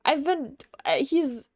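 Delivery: high, loud, hammed-up funny voice; funny theatrical character. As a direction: ham it up loud and high, like a stage adventurer. Plays a funny theatrical character in game.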